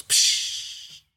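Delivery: a short breathy "Psh!" of dismissal; mock scoff. Girlfriend brushing him off with a scoffing psh. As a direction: blow out a quick unvoiced psh. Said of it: "she goes".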